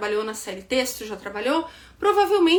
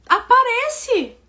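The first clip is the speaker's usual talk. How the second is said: aparece stretched out, high and louder; firm insistence; 9 semitones higher, 4 dB louder, slower than usual. Real, not acted. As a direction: drag out aparece as a firm order. Urging her students firmly.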